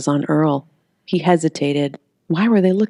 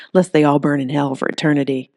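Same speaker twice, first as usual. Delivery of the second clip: ordinary delivery, nothing marked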